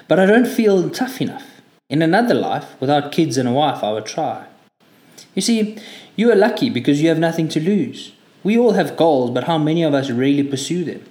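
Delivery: a hint of a French driver turned reflective; wistful French driver. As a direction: philosophize to the young recruit, slowing on the big lines.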